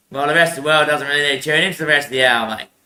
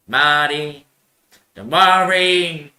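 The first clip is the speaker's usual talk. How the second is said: slow mock sermon; much slower than usual. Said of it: "Now, say just".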